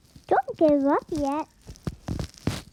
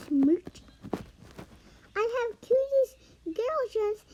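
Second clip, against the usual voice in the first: ordinary talk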